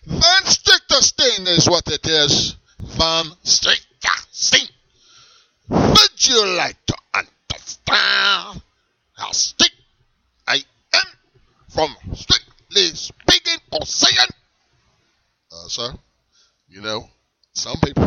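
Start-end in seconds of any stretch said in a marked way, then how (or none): 0.0-2.6 put-on slow, proud German accent intro; grandly naming himself in German accent; German accent name. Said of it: "What is your name?". 2.8-4.7 put-on Van Stichterstein stretched very slowly in German accent; slow, emphatic repeat of Van Stichterstein; drawn-out German name. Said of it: "What is your name?". 5.7-14.3 put-on slow, menacing German accent; strict German role, looming. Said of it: "your German accent".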